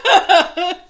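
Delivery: words pushed out through real laughter, quick; can't hold back the giggles; laughing and rushing the line. Real, not acted. Cracking up at the joke.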